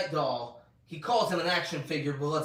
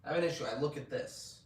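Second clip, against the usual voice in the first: ordinary talk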